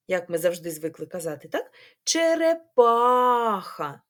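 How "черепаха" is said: ЧЕРЕПАХА very slowly, ПА held long, louder and higher; stress on ПА. Shown as a demonstration. Teacher asking which vowel stands out, ПА stretched. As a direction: hold the А in ПА of ЧЕРЕПАХА. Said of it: "трошки голосніше".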